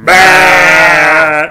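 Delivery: loud, pissed-off mock bark; hammed-up angry outburst; Gimli. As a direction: shout a disgusted, drawn-out bah. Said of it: "Gimli looks all pissed off and he goes".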